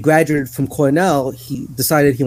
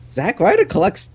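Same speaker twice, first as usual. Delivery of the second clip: surprised; 12 semitones higher than usual. Retold or imitated. Shocked fan recalls seeing him.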